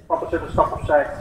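ordinary talk

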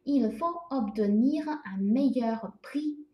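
slow, careful French read aloud; teacher's slow model sentence. Shown as a demonstration. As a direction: say it slowly so students can follow. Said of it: "比如说".

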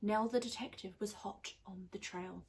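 plain reading voice

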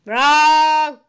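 loud quiz-host buzz-in; loud verdict. Pretend. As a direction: blare it out at the wrong guess. Quiz host rejects the guess.

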